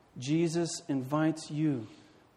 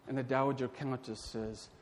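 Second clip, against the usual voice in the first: normal talking voice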